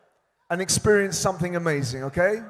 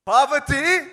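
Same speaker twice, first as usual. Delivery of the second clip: calling out; 7 semitones higher than usual. Real, not acted. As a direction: shout each name to the crowd. Calling out names to crowd.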